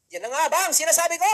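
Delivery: loud, high mother scolding. Retold or imitated. Mother scolding her teenage son about his baby, loud and angry. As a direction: mimic mom's furious told-you-so.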